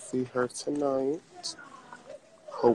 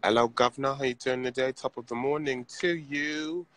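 playful British greeting, pitched up at the end; British accent; 2 semitones higher, 4 dB louder than usual. Shown as a demonstration. Vlogger showing off a British accent to fans on request, British accent. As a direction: put on a cheery British accent. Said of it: "Can you do a British accent?".

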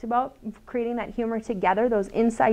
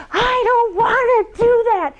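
put-on much higher, slow, drawn-out child whine; whiny tantrum child; 12 semitones higher, 5 dB louder, slower than usual. Daughter refusing her mother, mock whiny child voice. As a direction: imitate a nine-year-old mid-tantrum. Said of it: "she'd be like".

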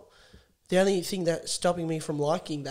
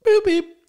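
mock bleep sound, much higher and louder; censor beep; 11 dB louder than usual. Host mock-censoring the guest, with a bleep noise. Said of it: "beep it out".

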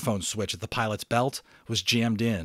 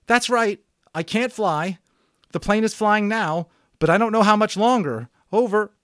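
much higher, much louder frightened plea; desperate pleading; 11 semitones higher, 8 dB louder than usual. Acted. Boy telling the radio he can't fly, desperate. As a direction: beg for help, near panic.

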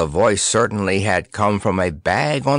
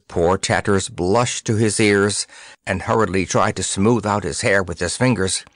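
ordinary delivery

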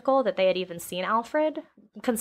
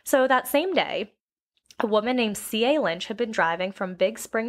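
ordinary delivery

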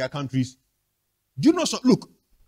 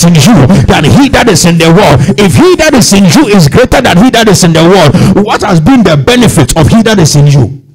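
loud, raised, urgent sermon delivery; full-force preaching; 3 semitones higher, 11 dB louder than usual. Real, not acted. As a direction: preach it out loud, driving through each phrase.

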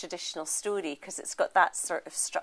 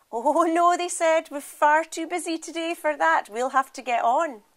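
higher, louder fussing refusal; old women declining tea, higher and louder; busy old women; 7 semitones higher, 7 dB louder than usual. Acted. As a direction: brush off the offer cheerily.

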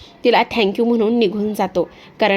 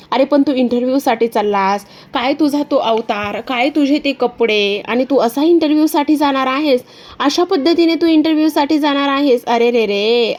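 Disha's sly voice; 3 semitones higher than usual. Acted. Disha calling from her car to Aditya, high and mocking. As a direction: sweet-talk him with a sneer. Disha mocks his clothes.

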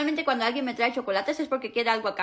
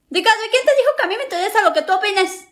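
loud, higher retort; angry shouting back; 5 semitones higher, 9 dB louder than usual. Acted. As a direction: snap back at full volume. Sister shouting back in argument.

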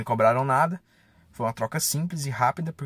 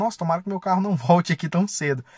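joking with a laugh; 6 semitones higher, 4 dB louder than usual. Retold or imitated. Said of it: "até brinquei na mecânica".

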